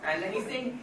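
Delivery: ordinary talk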